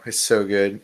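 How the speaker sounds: normal talking voice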